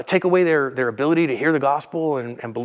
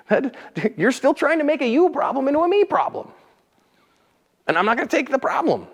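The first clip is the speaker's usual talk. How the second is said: mock exasperation; 12 semitones higher than usual. Continues acted reply.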